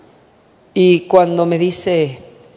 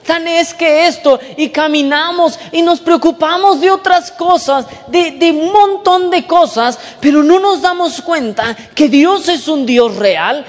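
higher, louder preaching at full force; 7 semitones higher, 4 dB louder than usual. Acted. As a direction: preach it out to the congregation. Preacher raises voice to congregation.

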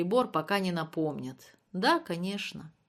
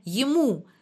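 raised, louder exclamation; cried out; 5 semitones higher, 7 dB louder than usual. A narrator's reading. Klyuev insisting to Olga it matters, crying out. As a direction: burst out with it, higher and louder. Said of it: "закричал Клюев".

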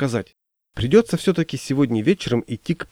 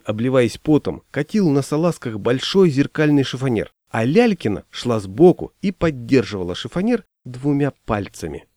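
plain reading voice

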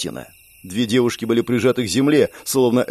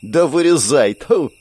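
defiant shout; 3 semitones higher, slower than usual. A narrator's reading. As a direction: shout it and spit. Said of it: "прокричала Лена и плюнула". (громкий дерзкий выкрик)

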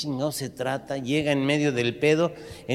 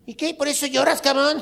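mocking punk; 12 semitones higher, 3 dB louder than usual. Punk mocks old man.

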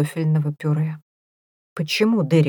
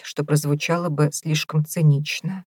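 plain reading voice

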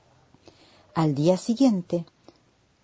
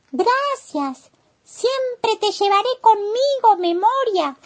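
vocecita muy aguda de caracol, sin susurrar; caracol agradecido; 4 dB louder, slower than usual. A narrator's reading. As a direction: agradece con voz agudísima de caracolito. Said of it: "susurró el caracol". (caracol agradecido, voz muy aguda)

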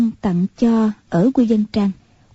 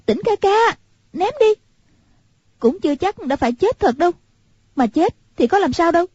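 put-on higher, quick girl's plea; young girl urging her companion to hurry; urgent young girl; 8 semitones higher, faster than usual. A narrator's reading. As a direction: hurry him along in a bright girl's voice. Hoàng Dung insisting.

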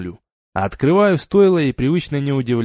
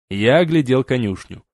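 plain reading voice

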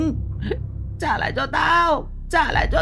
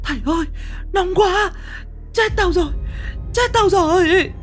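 shrill scream of pain. Acted. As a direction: howl as the fire burns her. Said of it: "Tiếng hét thất thanh của bác Thơm". (hét thất thanh vì đau đớn)

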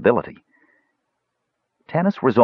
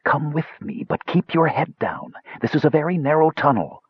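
whispery tenor; 8 semitones higher than usual. Acted. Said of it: "The voice rose to a tenor now, but remained whispery".

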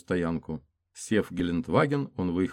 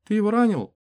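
high female voice; 8 dB louder than usual. Acted. Woman's question higher.

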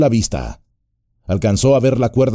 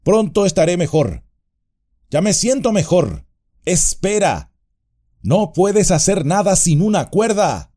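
higher, firmer call; Frodo steadier; 8 semitones higher than usual. A narrator's reading. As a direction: call up with returning strength. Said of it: "ahora con más fuerza".

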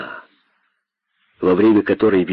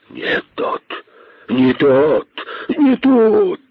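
hissed chant, climbing higher each time; hissing boas; 6 semitones higher, much slower than usual. A narrator's reading. Boas echo their leader, hissing. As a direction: start as a hiss and build in pitch. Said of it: "зашипели удавы".

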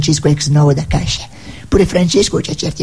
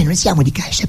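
ordinary delivery

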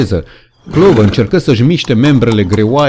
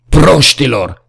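higher, louder furious cry; angry shout; 4 semitones higher, 3 dB louder, slower than usual. A narrator's reading. Benjamin stamping around the animals, shouting angrily. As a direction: scream at them in frustration. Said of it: "țipă Benjamin".

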